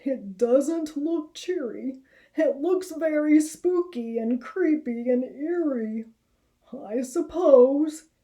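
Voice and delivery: nervous about the creepy woods; uneasy Harold. Acted. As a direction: Harold trying to stay brave. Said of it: "said Harold".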